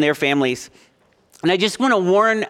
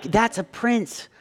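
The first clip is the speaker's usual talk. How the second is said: softer, slow coaxing; wife coaxing kids; 4 dB quieter, slower than usual. Retold or imitated. Wife softly coaxing the kids during the royal wedding. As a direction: coax the kids to look. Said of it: "being like".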